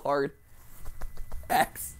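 ordinary talk, nothing marked

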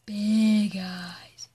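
soft, slow whisper; hushed wonder at the funny filter. Real, not acted. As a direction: breathe the words out in awe.

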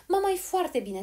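high, loud call to someone hard of hearing; girl calling out loudly; loud shouting. A narrator's reading. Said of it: "strigă foarte tare Polly".

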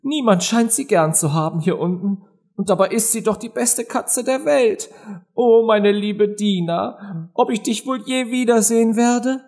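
high, plaintive little-Alice voice; downcast. A narrator's reading. Lonely Alice misses Dina, close to crying, downcast. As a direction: lament wistfully, pitched high. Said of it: "sagte sie betrübt".

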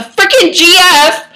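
exaggerated indignant yell; hammed-up outrage. Outraged emphasis in dramatic reading.

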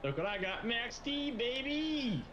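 high, rising brag; excited boast. Real, not acted. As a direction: show off your prize with a drawn-out holler. Showing off the truck he got.

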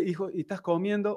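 soft, hushed mother asking her son; tender mother. Retold or imitated. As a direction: ask gently like a worried mother. Madre pregunta al hijo preso. (madre preocupada, voz suave y baja)

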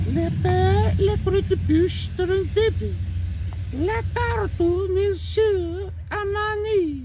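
very high squeaky puppet voice in fake French; high puppet babbling mock French. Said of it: "what else did he say".

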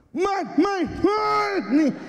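high nasal squeal rising to a shout; mock nasal cry. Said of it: "era fanhosa".

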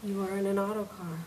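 a gentle woman speaking from above and behind; calm facility voice. Acted. The building's voice telling Mary where she is, calm and soft.

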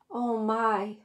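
ordinary delivery, nothing marked

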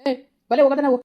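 father storming in with a shout; angry shout. Acted. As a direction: bark it at her in fury. Father bursts in angrily.

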